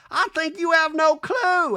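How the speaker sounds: high, loud, slow [name] put-down; mock [name], high and cutting; hammed-up [name], stretching clue; [name] impression. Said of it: "I heard [name] go".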